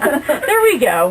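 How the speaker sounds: giggling give-up; laughing. Real, not acted. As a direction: laugh through the words. Laughing after the blooper.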